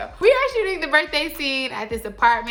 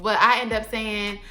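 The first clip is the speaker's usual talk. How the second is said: usual speaking voice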